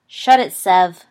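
sharp, loud snap; snapped. A narrator's reading. Evans shutting down Snape, snapping loudly. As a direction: cut him off hard. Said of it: "Evans snapped".

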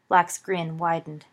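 ordinary delivery

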